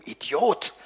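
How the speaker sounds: ordinary delivery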